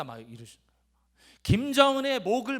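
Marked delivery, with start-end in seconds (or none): 1.4-2.6 mock shout. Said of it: "막 소리를 지릅니다".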